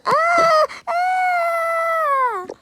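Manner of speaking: high, loud shrieking "Ah!"; terrified scream. Acted. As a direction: scream in fright at the thunder. Screams at thunder in storm.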